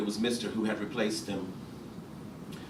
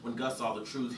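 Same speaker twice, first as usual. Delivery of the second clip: usual speaking voice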